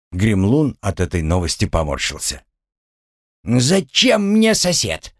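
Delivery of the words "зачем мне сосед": grumpy gremlin. A narrator's reading. Gremlin grimaces at news.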